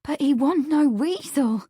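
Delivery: high, upset protest; tearful, breathless. A narrator's reading. Meg defends herself to Marsh, tearfully breathless. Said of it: "tearful breathlessness".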